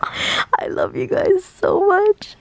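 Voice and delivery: high, slow and adoring; gushing. Real, not acted. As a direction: gush over the fans.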